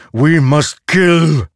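loud, high, slow demanding blade; ringing metallic sword voice. Acted. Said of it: "Nightblood demanded".

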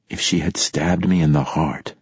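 ordinary delivery

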